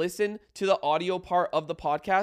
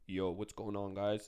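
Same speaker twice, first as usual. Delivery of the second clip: much lower, much softer, deep husky greeting; 9 semitones lower, 12 dB quieter than usual. Shown as a demonstration. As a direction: drop your voice as low as it goes. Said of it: "do I actually have a good deep voice".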